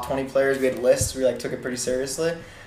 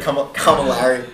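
slow, drawn-out New Jersey accent; 4 dB louder, slower than usual. Shown as a demonstration. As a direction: say Larry the Jersey way. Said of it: "My, my New Jersey accent really stuck through that".

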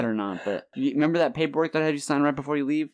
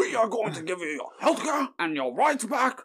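high-pitched, badly missed politician accent; hammed-up stump speech that drifts toward Dracula; Bernie Sanders impression; 8 semitones higher than usual. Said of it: "he's trying to do a Bernie accent".

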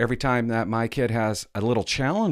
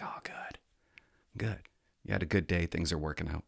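whisper; 5 semitones lower, 8 dB quieter than usual. Retold or imitated. Parents relieved whisper.

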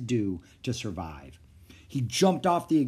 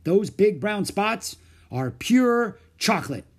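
amazed announcement; 8 semitones higher, 6 dB louder, slower than usual. Acted. Stunned doctor's revelation.

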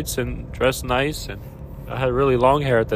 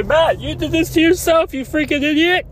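imitating a scolding viewer through tears, higher; mock scolding viewer; 14 semitones higher, 3 dB louder than usual. Imagined viewer scolding the vlogger, through tears. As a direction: throw the insult back at yourself. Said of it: "And you might say".

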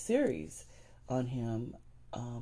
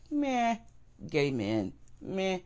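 dismissive cop; 6 semitones higher, slower than usual. Retold or imitated. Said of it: "cops are like".

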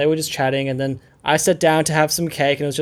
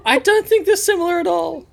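high, incredulous denial; amused disbelief. Real, not acted. Friend rejecting her accent claim, high and amused.

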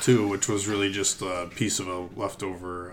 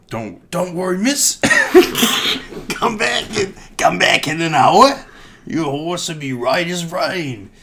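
high, hammed-up Cockney reassurance, louder in spots; Cockney farrier; 8 semitones higher, 6 dB louder than usual. Farrier reassuring the lady about her horse in Cockney. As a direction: play a cheeky Cockney horse doctor. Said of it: "That's definitely Cockney".

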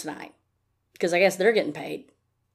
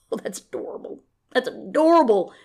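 higher, patronizing coo on ADORABLE; mocking condescension; 4 semitones higher than usual. Talking back to someone threatening her, mocking condescension.

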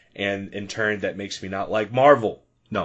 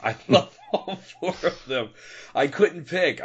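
laughing; 6 semitones higher than usual. Real, not acted. Laughing about a four-way tie.

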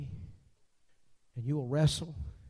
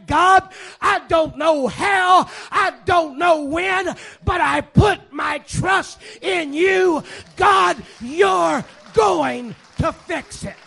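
loud, high, slow cry of trust; shouted trusting prayer; 13 semitones higher, 16 dB louder, much slower than usual. Retold or imitated. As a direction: shout your surrender to God, slowly. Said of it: "to the point that you say".

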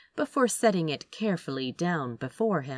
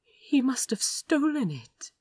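hushed, voiceless whisper; frightened bookseller whispering his guess; 8 semitones higher than usual. Acted. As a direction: breathe it out barely audible. Frightened confession at the desk.

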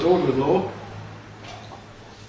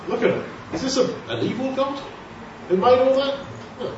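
ordinary talk, nothing marked

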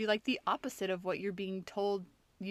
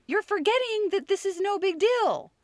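mock exasperated mom, higher and louder; impatient parent; 10 semitones higher, 7 dB louder than usual. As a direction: push the point on him, pitched way up. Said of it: "I'm like".

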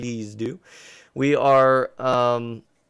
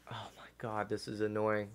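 frustrated whisper; 3 semitones lower, 11 dB quieter than usual. Real, not acted. Internet failing mid-podcast.

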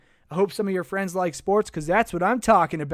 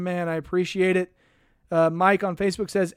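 usual speaking voice

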